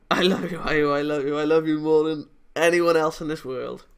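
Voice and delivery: shouted declaration of love; loud confession. Acted. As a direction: cry it out with all your heart. Confession shouted while running.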